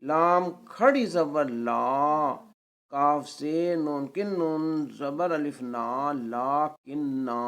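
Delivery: slow spelling, لا کنہ stretched to a long "naa"; long final vowel. Shown as a demonstration. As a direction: pausal reading, both long vowels of لا کنہ held out. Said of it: "اور وقف کی صورت میں". (وقف میں لا کنہ کا الف کھینچ کر)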